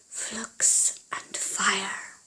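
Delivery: slow breathy whisper of a spell; hushed, slow magic words. A narrator's reading. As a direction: whisper it like a secret incantation. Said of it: "he whispered".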